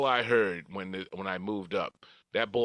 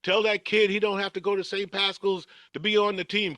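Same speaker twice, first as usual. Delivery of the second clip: excited coach; 9 semitones higher than usual. Retold or imitated. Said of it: "the coach was yelling".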